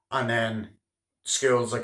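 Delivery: ordinary talk, nothing marked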